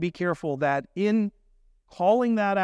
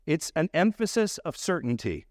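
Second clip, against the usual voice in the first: usual speaking voice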